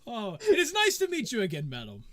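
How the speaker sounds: high lilting greeting that drops on the last word; a hint of the gallant gentleman; lightly voiced courteous nobleman. Nobleman greets a lady politely.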